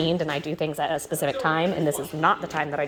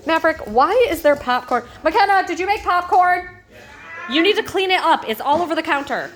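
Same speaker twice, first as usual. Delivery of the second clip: higher, louder scolding call; 11 semitones higher, 4 dB louder than usual. Real, not acted. Scolding kids about popcorn.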